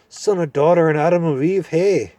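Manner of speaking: high, loud cranky dwarf; deaf old dwarf. Acted. Deaf dwarf mishearing the owl, loud and cranky. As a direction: mishear it and bark back.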